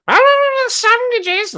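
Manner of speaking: very high, loud cartoonish shriek; mock screeching woman. Mimicking the woman in the background interrupting, high screech. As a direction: squawk it out like a woman yelling in the background. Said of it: "just hear someone going".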